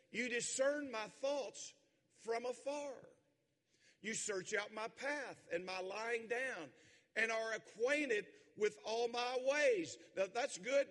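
loud, high, slow pulpit reading; fervent scripture preaching. Acted. Preacher reading the psalm aloud to the congregation. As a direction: proclaim the psalm with rising fire.

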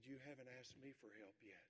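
soft and low; a father's quiet lesson; father quietly telling his son he never asked for help; gentle reveal. Retold or imitated. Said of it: "His dad said this".

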